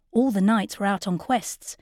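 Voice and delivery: plain reading voice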